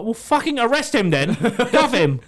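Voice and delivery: mock-yell it at a useless officer; exasperated retort. Retelling argument with police.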